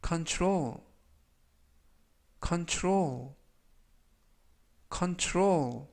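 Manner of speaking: control said slowly three times as a model; model pronunciation. Shown as a demonstration. Teacher modeling control for learners to repeat. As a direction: say control clearly for students to repeat. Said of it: "이라고 발음하시면 되겠고요".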